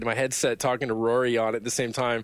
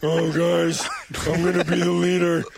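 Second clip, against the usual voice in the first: higher, louder, thick strangled fat-guy voice; pork chop stuck in the larynx voice; 4 semitones higher, 4 dB louder than usual. Acted. Gamer doing a silly fat-man voice over team chat, strangled and loud. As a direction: talk like meat is lodged in your throat. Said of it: "pork chop stuck in the larynx voice".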